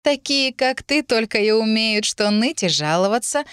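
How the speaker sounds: high, mocking girl's voice; gloating jeer. A narrator's reading. Lilith unafraid of the threat, jeering. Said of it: "глумливо рассмеялась Лилит".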